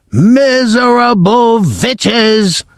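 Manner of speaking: contemptuous shriek at her followers; Grand High Witch yelling. Acted. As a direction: spit the insult out slowly and high. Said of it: "she yelled".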